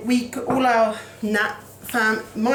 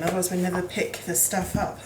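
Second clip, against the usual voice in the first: normal talking voice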